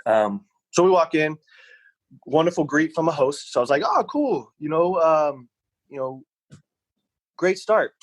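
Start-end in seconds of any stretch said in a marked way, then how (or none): 3.9-7.9 higher, bright mock-delighted reply; lightly hammed-up delight; mock cheery. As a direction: play the hopeful diner, pleased at the start. Retelling his hopeful reaction.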